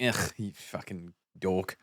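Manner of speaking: ordinary delivery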